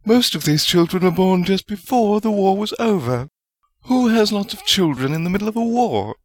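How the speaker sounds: a touch of tartness, understated; tart chiding. A narrator's reading. Woman chiding two colleagues, mildly tart. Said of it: "Rowena's voice was tart as she chided".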